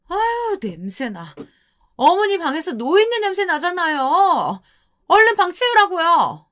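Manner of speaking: high, contemptuous nagging; nasty scolding. Acted. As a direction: sneer at the old woman, pitched high. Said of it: "더 가관이었습니다".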